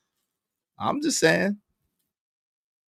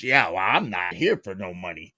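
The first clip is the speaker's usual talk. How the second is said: deep, soft mocking voice of J P; 6 dB quieter than usual. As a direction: drop low and sneer it out as J P. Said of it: "it's like J P".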